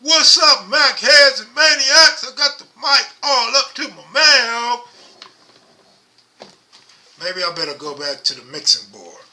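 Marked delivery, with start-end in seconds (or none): 0.0-4.9 booming shout right into the mic, pitch jumping way up; loud mic-hogging greeting. Shown as a demonstration. Answering viewers who can't hear him with a loud hyped shout. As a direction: yell the greeting like your mouth is on the mic. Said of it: "You want me to talk like this".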